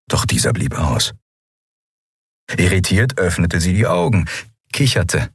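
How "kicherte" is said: ordinary delivery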